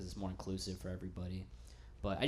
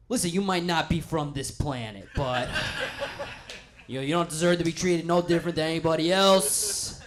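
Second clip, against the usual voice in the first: louder, higher, in a put-on voice with a laugh; awkward kind owner; 7 semitones higher, 11 dB louder than usual. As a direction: reassure too hard, like a nervous good guy. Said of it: "the guy's just like".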